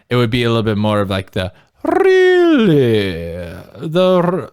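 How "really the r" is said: put-on high, slow plummy royal British accent; mock upper-class British drawl; hammed-up royal British; posh royal British. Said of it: "since we're talking about royal people".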